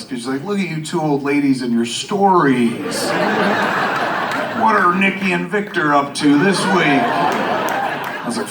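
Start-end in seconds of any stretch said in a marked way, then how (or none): 0.4-7.0 mildly mocking wife's voice; teasing. Wife makes fun of two friends' TV habit, teasing. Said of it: "my wife just made fun of us".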